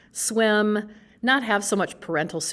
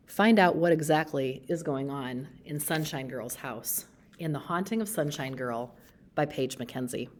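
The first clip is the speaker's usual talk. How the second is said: ordinary delivery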